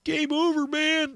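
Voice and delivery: high, panicked Hudson wail; Private Hudson impression. Host quoting Aliens, panicked Hudson wail. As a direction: quote Hudson losing it. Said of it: "Private Hudson in Aliens".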